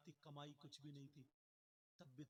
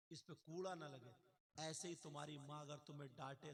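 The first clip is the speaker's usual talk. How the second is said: ordinary delivery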